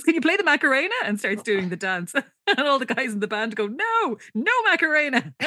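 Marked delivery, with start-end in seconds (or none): 0.0-0.9 high, eager imitation of a fan; excited. Recapper voicing Enid running up to the band, excited. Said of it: "Enid gets all excited when she sees them". 3.7-5.3 high-pitched mock-distressed protest; distressed refusal. Recapper voicing band members refusing a song request, comically distressed. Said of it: "And all look really distressed".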